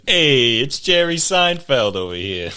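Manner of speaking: brash mock Brooklyn greeting; Brooklyn accent impression. Friend joining in on a sketch, mock Brooklyn accent. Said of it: "some Indian guy with a Brooklyn accent".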